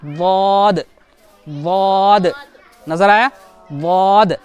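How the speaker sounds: वाद from the tongue's side, thick; Arabic daad. Shown as a demonstration. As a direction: press the tongue edge for वाद. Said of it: "अच्छे से देखना गौर से".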